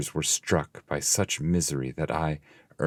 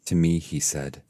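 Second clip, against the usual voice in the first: plain reading voice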